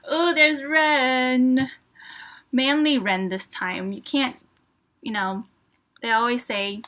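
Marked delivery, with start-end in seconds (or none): 0.1-3.0 long, loud, drawn-out "ooh"; delighted cooing. Real, not acted. Spotting a favorite member in book.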